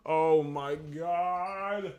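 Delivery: hugely drawn-out exasperated wail; exasperated groan. Real, not acted. Viewer stuck with an ad, exasperated. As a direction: drag every word out in exasperation. Forced to watch an ad.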